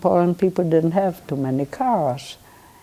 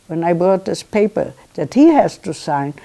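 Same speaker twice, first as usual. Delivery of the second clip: normal talking voice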